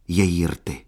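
ordinary delivery

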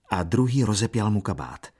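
ordinary delivery, nothing marked

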